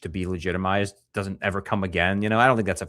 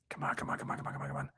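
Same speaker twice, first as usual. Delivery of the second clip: eager whispered chant; whispered come on; 13 dB quieter, faster than usual. Real, not acted. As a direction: whisper it, urging the video on.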